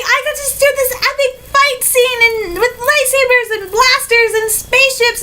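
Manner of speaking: high-pitched gushing, piling up the list; bursting with excitement. Retold or imitated. Actor longing to tell about the day's shoot, bursting. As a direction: gush like a kid who can't hold it in. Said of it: "you're bursting with excitement 'cause you wanna say".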